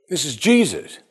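usual speaking voice

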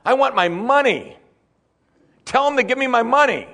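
high-pitched, whiny mock demand; hammed-up high voice, insisting on the cash; greedy demand. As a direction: imitate a man clamouring for his money. Preacher voices greedy man's demand.

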